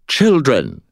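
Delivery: high, loud address full of contempt; haughty, swooping call of disdain; contemptuous. A narrator's reading. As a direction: scorn them like wayward children. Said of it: "her tone full of contempt".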